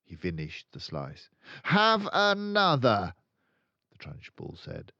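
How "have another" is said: high, loud, slow menacing tyrant; pushy offer. A narrator's reading. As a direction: press it on him like a threat. Trunchbull pressing cake.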